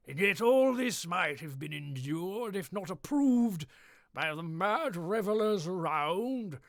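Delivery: lightly voiced grand narrator, slow and ominous; dramatic story narration. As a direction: savour each dreadful detail slowly. Dramatic reading in put-on voice.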